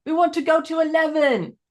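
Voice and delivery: put-on high-pitched yelling in a Scottish accent; Scottish shout. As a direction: shout at a machine like a Scottish guy. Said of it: "they're, like, shouting at it".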